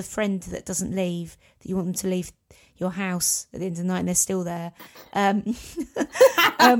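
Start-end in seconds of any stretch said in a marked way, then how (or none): 5.5-6.5 high, loud burst of laughter. Real, not acted. Laughing at her own joke.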